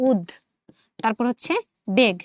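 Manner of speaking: উদ and বেগ said as separate pieces; broken into parts. Shown as a demonstration. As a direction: split উদ্বেগ into "ud", then "beg". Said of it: "উচ্চারণটা আসলে আমরা এরকম করে করছি".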